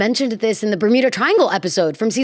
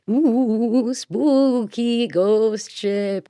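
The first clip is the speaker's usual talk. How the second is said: mock spooky; 2 semitones higher, much slower than usual. Hamming up a spooky topic.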